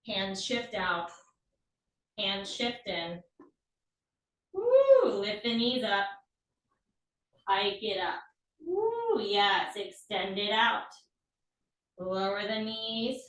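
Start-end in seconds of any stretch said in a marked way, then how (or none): 4.5-5.1 excited woo. Real, not acted. Cheering during the move. 8.6-9.2 excited woo. Real, not acted. Cheering during the move.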